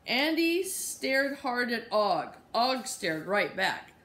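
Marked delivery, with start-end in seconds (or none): none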